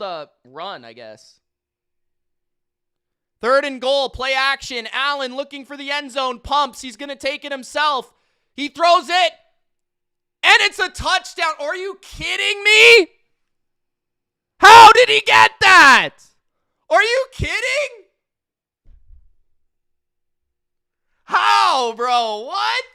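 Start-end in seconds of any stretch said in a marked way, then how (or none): 3.4-11.5 announcer call building to a higher, louder touchdown shout; play-by-play call. Acted. Streamer calling the Bills' touchdown play, building to a shout. 11.6-22.8 much higher, louder yells of disbelief; shocked yelling. Real, not acted. Streamer yelling at the TV after the touchdown, shocked. Bettor sees his spread lost.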